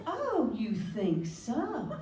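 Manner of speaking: slow, laughing mock-sarcastic "Oh, you think so?"; sarcastic wife. Wife mocking her husband, slow sarcastic laughing. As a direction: drag it out like a wife who isn't buying it. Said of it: "If she says".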